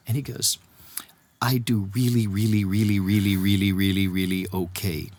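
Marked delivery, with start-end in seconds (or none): 1.4-5.1 low, monotone string of reallys; imitated reluctant admission. Embarrassed star admitting fame to friend, low and reluctant. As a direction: drop low and grind evenly through the repeats.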